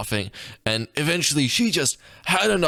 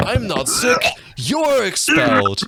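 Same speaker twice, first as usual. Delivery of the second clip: mock retort; 10 semitones higher, 4 dB louder than usual. Kid retorts in story.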